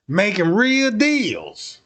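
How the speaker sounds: normal talking voice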